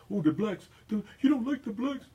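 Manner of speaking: high, loud mocking sneer; mock conservative voice. Radio host mocking conservative critics, high sneering put-on voice.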